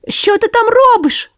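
very high curious puppy voice; little dog asking. A narrator's reading. Sonya asks the echo in the hatch, high puppy voice. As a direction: ask down the hole in a high puppy voice. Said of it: "запитала Соня".

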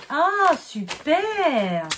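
high, very slow delighted "super". Real, not acted. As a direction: stretch out your delight at the gift. Delight at opening a gift. (ravie, aiguë et très traînante)

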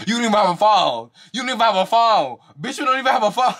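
high-pitched, repeated mocking jab; mock argument. Acting out the argument's repeated insult.

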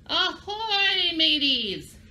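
high, hearty pirate hail; pirate greeting. Shown as a demonstration. Teacher practising the pirate hello with kids, high and hearty. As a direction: sing it out like a cheerful pirate. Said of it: "practice one more time".

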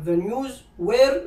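drawn-out rising were; the wrong verb, dragged out; wrong form. Shown as a demonstration. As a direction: slowly hold were like a trap. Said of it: "لا".

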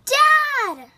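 high, loud joyful cry of Dad; joyful shout. Acted. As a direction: call out with pure delight. Running to greet returning dad.

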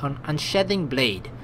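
usual speaking voice

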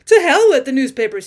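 loud, vindictive growl; a loud angry growl at the newspapers. A narrator's reading. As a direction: spit it out in sullen rage. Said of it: "he growled".

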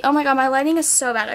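ordinary talk

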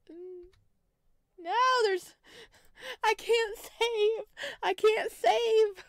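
normal talking voice

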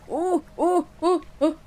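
quick high "ooh" hoots; alarmed oohs. Real, not acted. Player spots a rock ahead, alarmed oohs. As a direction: yelp short oohs at sudden danger. Boat about to hit a rock.

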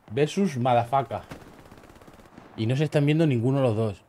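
ordinary talk, nothing marked

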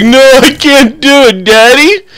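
put-on high, slow whiny little kid voice. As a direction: whine it like a small child refusing. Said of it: "And Davey's like".

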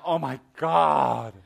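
loud, stretched-out horror; acted fright, drawing out the last word; horrified shock. Acts out reaction to staring eyes.

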